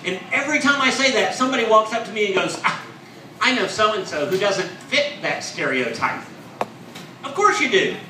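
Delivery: ordinary talk, nothing marked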